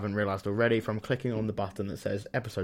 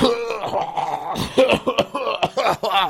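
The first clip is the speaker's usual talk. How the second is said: coughing fit; 10 semitones higher, 4 dB louder than usual. Real, not acted. Choking mid-sentence.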